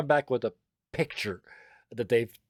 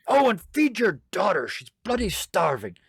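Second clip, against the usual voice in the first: loud, high nagging call; raised-voice domestic scolding; scolding wife; 8 semitones higher, 6 dB louder than usual. Acted. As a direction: call after a husband with exasperation. Wife scolds departing husband.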